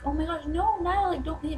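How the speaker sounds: high-pitched fangirl pleading; retold panicked plea. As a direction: replay begging the singer to stay. Replays pleading with departing singer.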